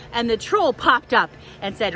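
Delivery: usual speaking voice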